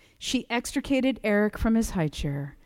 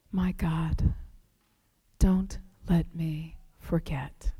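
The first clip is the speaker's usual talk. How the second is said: soft, slow whisper; hushed plea to God; whispered prayer; 3 semitones lower, 6 dB quieter, slower than usual. Retold or imitated. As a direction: pray it under your breath.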